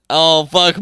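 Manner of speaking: ordinary delivery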